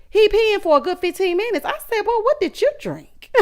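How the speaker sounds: high, amused disbelief; high-pitched mock astonishment tipping into a laugh; incredulous, breaking into laughter. Real, not acted. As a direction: squeal it out on the edge of laughter. Cracking up at long pee.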